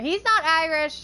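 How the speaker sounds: loud, high, slow correction; indignant correction. Real, not acted. Girlfriend loudly correcting chat that he is Scottish. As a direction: shout it out, offended on his behalf.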